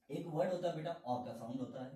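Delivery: ordinary talk, nothing marked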